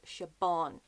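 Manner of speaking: Siobhan said with a hard B, shuh-BAWN; mispronounced Siobhan. Shown as a demonstration. Irish vlogger quoting how others say an author's name, mispronounced. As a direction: say Siobhan wrongly with a B sound. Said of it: "I hear her name being pronounced as".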